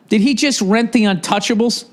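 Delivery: usual speaking voice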